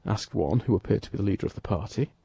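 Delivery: ordinary delivery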